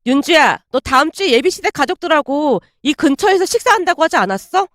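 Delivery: ordinary delivery, nothing marked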